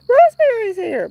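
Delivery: high excited exclamation; surprised delight. Retold or imitated. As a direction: blurt it out thrilled. Retold thought on seeing name.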